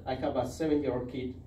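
ordinary delivery

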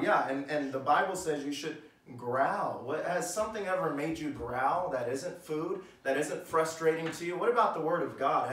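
ordinary talk

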